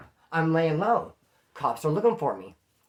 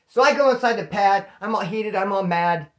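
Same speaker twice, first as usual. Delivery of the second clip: louder, worked-up telling; heated retelling; 4 semitones higher, 6 dB louder than usual. Retold or imitated. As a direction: tell it all fired up and mad. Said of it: "Oh, that kinda rhymed".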